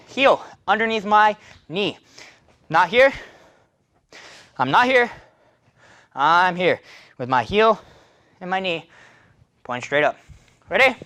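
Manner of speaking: usual speaking voice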